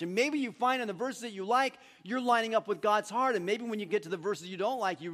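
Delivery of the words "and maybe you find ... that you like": normal talking voice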